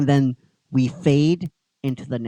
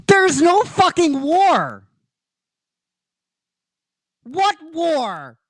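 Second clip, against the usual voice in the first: high, loud, slow disbelieving yell; incredulous outburst; exasperated yell; 13 semitones higher, 8 dB louder, slower than usual. Real, not acted. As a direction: blow up at the idea. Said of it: "own outburst".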